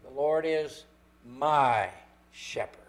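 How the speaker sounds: MY set apart by pauses; pause, a long "my", pause; stress on MY. Shown as a demonstration. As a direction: isolate and stretch the word "my". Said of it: "You could emphasize every word of it".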